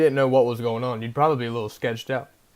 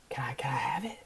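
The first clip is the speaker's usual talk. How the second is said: much softer, hushed stranger voice; imitating the paint guy; 10 dB quieter than usual. Teller imitates the man at the dumpster, hushed and soft. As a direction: half-whisper him like a spaced-out drifter. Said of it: "I swear this is how he talks".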